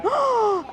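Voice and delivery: high sudden gasp of shock; sharp gasp. Retold or imitated. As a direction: gasp as if seeing the fall. Dramatic moment in chat story.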